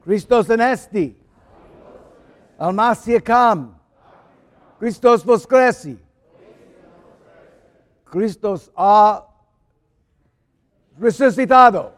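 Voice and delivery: loud joyful proclamations in many languages; paschal greetings. Real, not acted. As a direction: call each greeting out to the church. Easter greeting in several languages.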